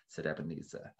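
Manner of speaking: usual speaking voice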